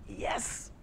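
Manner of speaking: breathy whispered triumph; a private little victory whisper; hushed delighted yes. Retold or imitated. Quiet private joy at sale.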